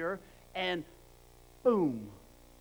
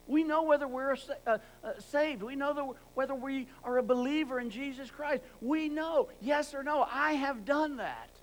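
higher and louder, pressing every "We know"; fervent preaching; 6 semitones higher, 6 dB louder than usual. Acted. Preacher insists the congregation knows if they are saved, fervent. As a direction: drive it home to the congregation.

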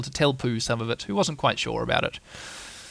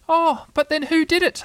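put-on much higher, eager child voice; small kangaroo voice; 5 dB louder than usual. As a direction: ask it like a tiny keen kid. Roo asks.

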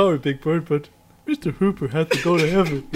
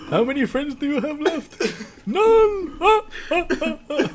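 high laughing count; mock Count counting; 10 semitones higher than usual. Mocking the Count's counting, high and laughing. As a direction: count gleefully like the Count. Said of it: "counting like the Count".

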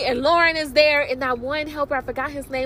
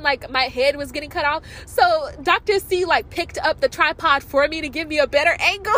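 ordinary delivery

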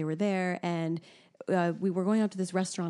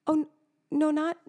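sheepish reply; 9 semitones higher than usual. Retold or imitated. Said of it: "And I went".

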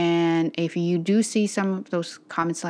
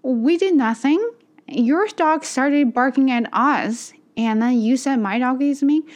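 higher, louder, slow outraged protest; indignant retort; 7 semitones higher, 5 dB louder than usual. Retold or imitated. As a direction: let the outrage spill out, fighting back tears. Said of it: "I'm like".

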